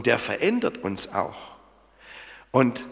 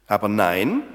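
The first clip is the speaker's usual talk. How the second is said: ordinary delivery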